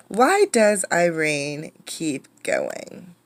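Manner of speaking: ordinary delivery, nothing marked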